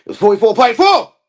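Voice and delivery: high, loud outburst; indignant shout. Acted. Correcting someone over a figure, indignant shout. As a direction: yell the figure in protest.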